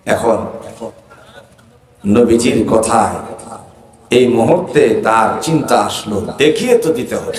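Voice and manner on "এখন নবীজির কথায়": usual speaking voice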